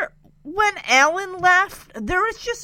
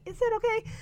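put-on sweet girl voice; 7 semitones higher, 8 dB quieter, faster than usual.

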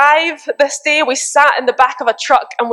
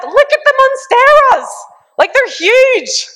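much higher, slow giddy yell; excited shouting; 13 semitones higher, 3 dB louder, slower than usual. Retold or imitated. Friends seeing huge monsteras, giddy shouting. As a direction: squeal it out at the giant plants. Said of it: "we were just like".

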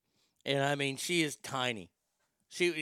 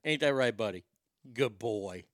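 normal talking voice

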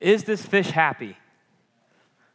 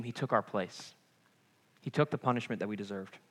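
quiet; 6 semitones lower, 9 dB quieter than usual. Real, not acted. Solemn turn in the sermon.

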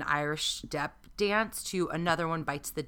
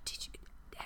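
hushed, very soft whisper; barely audible mock-ghost murmur; pretend ghostly whisper; 19 dB quieter than usual. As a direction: whisper as if eavesdropping from beyond. Imagines eavesdropping as a ghost.

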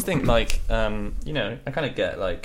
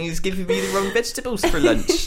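mock old villager; 8 semitones higher, 5 dB louder than usual. Playing old villager complaining.

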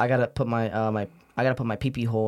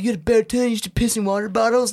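mocking bully voice; 8 semitones higher, 4 dB louder than usual. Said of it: "they bully me".

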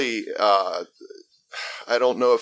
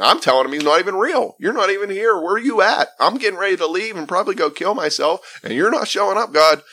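retold yelling at God; 9 semitones higher than usual. Recovering addict re-voices his angry prayer to God, high mock yell. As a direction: replay the desperate rant at God, pitched up. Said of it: "I can sit there and yell at God".

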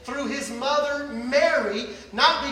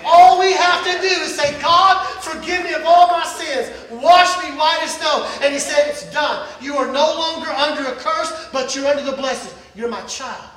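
loud, rising preacher's call over music; full-force preaching; 3 semitones higher, 8 dB louder than usual. Acted. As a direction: preach it out, building to a shout.

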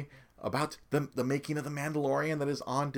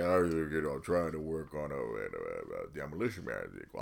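Stallone impression; 8 semitones lower, 7 dB quieter than usual. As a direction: drop low and mumble like Stallone. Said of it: "He's like".

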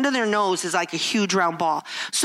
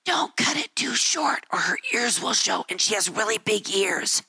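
imitating mother's whisper to the beautician. Mother whispering to beautician about daughter's ears, whispered. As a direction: hush it behind her back. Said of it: "she would whisper as if I couldn't hear".

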